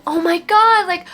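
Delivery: high, loud shocked gasp of a relative; shocked exclamation. Retold or imitated. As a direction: blurt it out in shock. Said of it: "they went".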